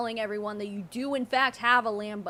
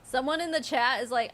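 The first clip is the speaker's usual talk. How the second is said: normal talking voice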